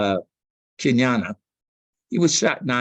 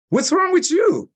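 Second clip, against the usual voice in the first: imitating Mary's high voice; 3 dB louder than usual. Mary asks, tears falling.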